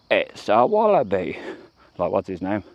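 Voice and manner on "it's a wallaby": slow, drawn-out fake Australian accent as American TV does it. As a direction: ham up the Hollywood Australian accent. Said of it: "fake Australian accent that a lot of American T V shows do".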